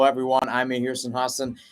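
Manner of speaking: normal talking voice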